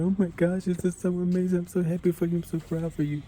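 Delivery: high, excited gushing; gushing joy. Retold or imitated. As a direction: squeal with pride and joy. Crying crowd hugging after crossing.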